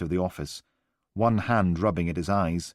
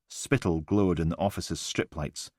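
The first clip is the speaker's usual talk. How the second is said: ordinary delivery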